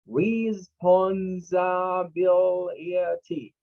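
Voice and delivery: RESPONSIBILITY stretched very slowly, each syllable held. Shown as a demonstration. Teacher breaking a six-syllable word down for students, syllable by syllable. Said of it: "It's a word with six syllables".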